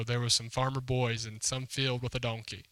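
ordinary delivery

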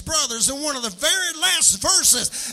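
loud, high, singing preacher's cry to the congregation; full-force preaching. Acted. Preacher whooping to his congregation about God's plan, loud and sung. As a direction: preach it out with a rising, musical whoop.

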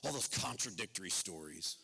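a lightly voiced scoffer questioning scripture; the preacher mimics doubters of the Bible, low and scornful; mock skeptic. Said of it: "some people go".

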